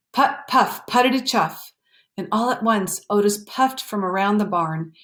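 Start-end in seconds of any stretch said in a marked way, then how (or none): 0.1-1.7 higher, livelier engine chug. A narrator's reading. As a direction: puff it out as it gets closer. Sound became louder.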